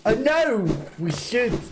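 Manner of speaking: ordinary talk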